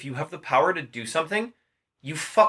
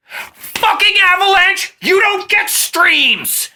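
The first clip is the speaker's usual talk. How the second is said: much higher and louder, slow and drawn out; hammed-up raging curse at the traitor island; furious yell; 9 dB louder, much slower than usual. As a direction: bellow a curse down on them.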